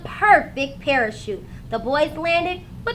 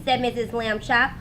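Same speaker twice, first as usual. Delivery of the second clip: ordinary delivery, nothing marked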